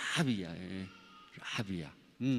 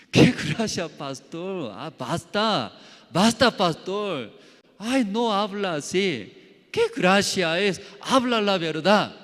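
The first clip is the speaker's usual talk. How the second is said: higher, louder, fed-up retort; exasperated protest; 7 semitones higher, 8 dB louder than usual. Retold or imitated. Preacher retelling his irritated reply to the fallen pastor. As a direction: push back hard, you've had enough.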